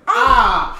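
loud, high mock scream; shocked scream. Retelling his reaction to a crude line, shocked yell. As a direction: yelp in exaggerated shock.

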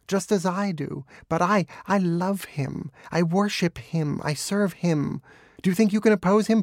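high, impassioned dog voice; worked-up, yelping fervour; quivering exultation. A narrator's reading. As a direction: declare devotion at a high pitch, exultant. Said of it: "quivering with exultation".

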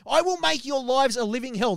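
high, loud vow of revenge; furious threat. Retold or imitated. Jim threatening the comedians, high and loud. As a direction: spit the threat at them. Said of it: "That's a direct quote".

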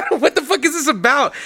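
high, rising disbelief on what; baffled at a 2am message out of nowhere; incredulous. Retold or imitated. Retelling confused reaction to cohost, high incredulous question.

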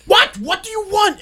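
high, loud exasperated yell; acting out an irritated shout back; pissed off. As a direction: yell back at whoever keeps calling. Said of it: "I'm getting pissed off".

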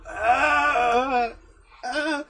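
high, loud puzzled shout; loud high quote. Retold or imitated. Guy out of shower quoted.

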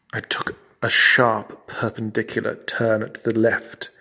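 ordinary delivery

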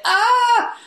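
sudden high loud cry of dismay; frustrated yelp. Real, not acted. Host laments being cut from the film, high loud yelp. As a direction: let out a sharp anguished "ah".

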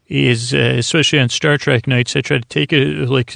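usual speaking voice

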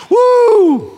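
a high, loud celebratory whoop; joyful whoop. Real, not acted. Preacher whooping to the congregation over forgiven sins, shouted. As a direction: throw a big woo to the rafters.

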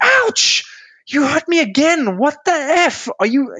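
very high, loud shriek of ouch, then furious; pained outraged yell. Retold or imitated. Dancer with broken toe screaming at partner, shrill pained yell. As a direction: scream it like someone stepped on your toe. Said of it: "And you're like".

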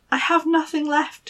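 a hint of a despairing maiden. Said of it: "she said".

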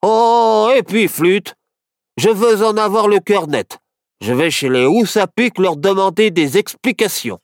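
loud, high fed-up outburst; annoyed. A narrator's reading. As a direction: snap out of patience. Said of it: "Il reprit, agacé".

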